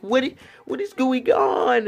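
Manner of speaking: high, loud, baffled question; stunned disbelief. Retold or imitated. Watching the breakup scene, high loud baffled disbelief. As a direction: blurt it out in shock. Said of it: "I was just like".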